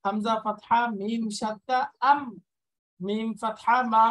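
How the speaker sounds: loud, deliberate letter-by-letter spelling; teacher spelling loudly; spelling aloud. Shown as a demonstration. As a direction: call out each letter to the class. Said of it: "উচ্চারণটা like এরকম হবে".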